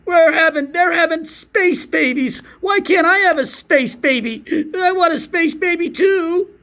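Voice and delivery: squealing mock-sobbing lament; mock hysterical wife. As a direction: ham up a wife sobbing over space babies. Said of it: "this lady's taking it dead serious, like".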